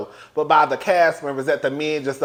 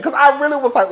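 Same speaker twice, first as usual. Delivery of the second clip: ordinary talk, nothing marked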